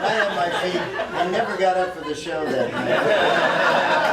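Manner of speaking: loud, high, laughing throughout; can barely get it out for laughing; laughing hard. Real, not acted. Laughing loudly remembering Jim collapsing at his feet.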